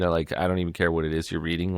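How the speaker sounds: normal talking voice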